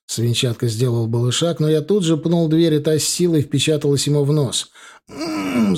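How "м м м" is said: high, pained mmm moan through a hurt nose; high-pitched nasal groan of pain. A narrator's reading. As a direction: moan as the door hits his nose. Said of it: "замычал мужик".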